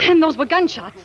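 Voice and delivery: loud, frightened outburst; jolted by the shots; startled alarm. Acted. Woman startled by gunshots, loud and frightened.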